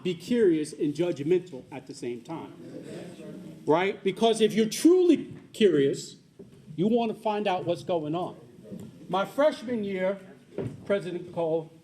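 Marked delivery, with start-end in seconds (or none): none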